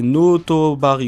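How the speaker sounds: "Notobari" slowly sounded out, loud and drawn out; botched pronunciation. Real, not acted. French YouTuber tries a Thai place name, botched slow "Notobari". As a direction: stretch "Notobari" loudly, unsure of the foreign name. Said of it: "excusez-moi pour la prononciation éclatée".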